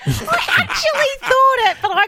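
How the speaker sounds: laughing insistence. Real, not acted. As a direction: insist while cracking up.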